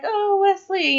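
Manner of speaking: high-pitched mock swoon; swooning heroine. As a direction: mimic a lovestruck princess. Retelling lovestruck Buttercup's cry.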